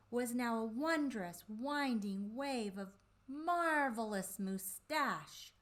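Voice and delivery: plain reading voice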